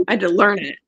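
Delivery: ordinary delivery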